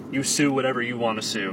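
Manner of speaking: ordinary talk, nothing marked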